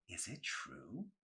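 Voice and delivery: slow hushed question; whispered. A narrator's reading. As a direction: lean in and whisper it. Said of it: "Mandy whispered".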